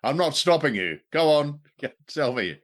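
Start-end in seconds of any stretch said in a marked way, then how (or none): none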